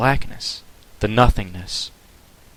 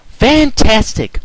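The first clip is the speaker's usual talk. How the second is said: slow, high, louder delight; grinning delight; 13 semitones higher, 5 dB louder, much slower than usual. A narrator's reading. Twilight hearing the time, slow high delight. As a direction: stretch it out with a grin. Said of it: "grinning from ear to ear".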